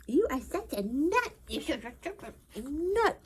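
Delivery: high, drawn-out pet voice. Real, not acted. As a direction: tease the dog fondly. Teasing the dog.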